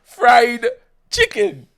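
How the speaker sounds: loud, high "Fried", then "chicken"; emphatic example. Shown as a demonstration. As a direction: announce each word separately, amused. Giving ED examples while laughing.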